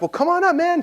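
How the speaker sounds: very high-pitched, eager invite; excited squeal of welcome; enthusiastic invitation. Retold or imitated. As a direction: urge your buddy to come join you. Acted enthusiastic invitation to friend.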